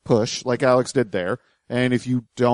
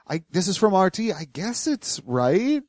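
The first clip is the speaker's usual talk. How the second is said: hesitant mimicry; 6 semitones higher, 4 dB quieter than usual. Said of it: "You can hear the hesitance".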